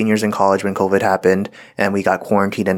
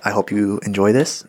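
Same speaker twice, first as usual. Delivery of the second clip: ordinary talk, nothing marked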